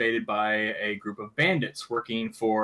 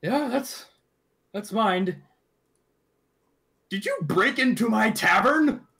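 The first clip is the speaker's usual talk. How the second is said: dwarf voice; 7 semitones higher than usual. Acted. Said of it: "takes the helmet and is like".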